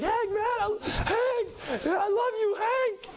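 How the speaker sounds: very high, cracking shriek with a laugh; crazed fan. Retold or imitated. Fan going crazy at Hank, shrieking high and laughing. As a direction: lose it completely like a starstruck fan. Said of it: "He's just going crazy".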